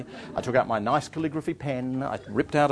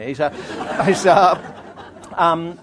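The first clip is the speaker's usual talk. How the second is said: ordinary talk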